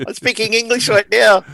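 hammed-up posh English accent, high and loud; mock English accent. Mimicking David Tennant's forced English accent for a podcast co-host, mock English accent. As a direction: switch to an over-the-top English accent for the joke. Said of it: "David Tennant did Doctor Who and had to do a non-Scottish accent".

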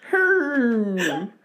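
high, slow, sobbing "Herm"; mock accent. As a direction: draw it out in a weepy wail. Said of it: "saying home with an accent".

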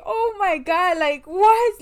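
retold crying. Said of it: "couldn't get the words together".

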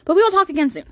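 quick, high redo of a slow sign-off. Real, not acted. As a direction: pick up the pace and pitch it up. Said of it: "I don't know why I'm talking so slow".

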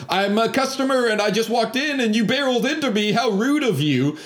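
high, affronted protest, acted out; mock indignation. Imitating him lying to the woman, mock indignant. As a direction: turn the blame on her. Lying man turns blame on her.

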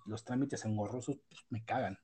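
ordinary talk

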